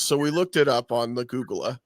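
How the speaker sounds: ordinary talk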